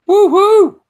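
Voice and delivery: loud high cheer; whoop of joy. Real, not acted. Wrestler whoops at the win, loud high cheer. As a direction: whoop like the crowd just popped.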